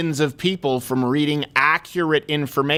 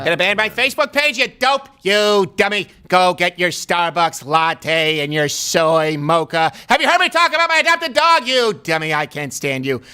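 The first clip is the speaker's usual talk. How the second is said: Mark Levin impression. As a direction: ham up an outraged radio host yelling at a dummy. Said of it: "That's his happy voice".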